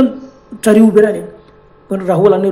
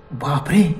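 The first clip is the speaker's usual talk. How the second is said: ordinary delivery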